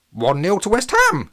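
high, loud squawking parrot. Acted. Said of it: "squawked Jabba".

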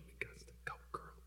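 barely audible whispered nudge; urgent whisper. Retold or imitated. Husband discreetly nudging his wife to leave, whispering. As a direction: whisper it so only she hears.

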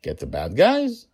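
put-on high, tentative child's question; timid little girl daring to ask; hesitant, pitch rising up high; half-shaking voice. A narrator's reading. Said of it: "her voice half shaking".

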